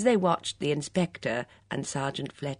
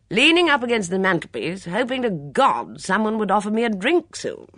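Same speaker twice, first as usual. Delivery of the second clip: loud, emphatic, forthright countrywoman; brisk no-nonsense woman; 5 semitones higher, 7 dB louder than usual. A narrator's reading. Witness bluntly answering the inspector's questions, brisk and loud. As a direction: answer bluntly and heartily, voice raised. Voices Miss Hinchcliffe.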